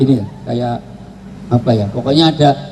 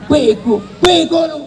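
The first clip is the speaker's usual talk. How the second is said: high, yelled Jakarta-slang insult; mock angry yell, pitched way up; shouted insult; 12 semitones higher than usual. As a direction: bark it like a street quarrel.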